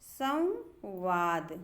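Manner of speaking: संवाद very slow, म sounded before वाद; careful pronunciation. Shown as a demonstration. Teacher presents the finished word to students, slowly. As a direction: stretch संवाद syllable by syllable. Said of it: "हमारा शब्द बन जाता है".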